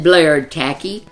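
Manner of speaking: ordinary delivery, nothing marked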